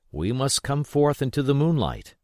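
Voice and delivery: usual speaking voice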